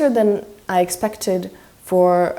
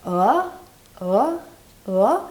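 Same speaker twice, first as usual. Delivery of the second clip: uh sliding from mid pitch to higher; rising tone; 3 dB quieter, much slower than usual. Shown as a demonstration. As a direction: say uh like a short question, rising. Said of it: "go from mid tone to higher".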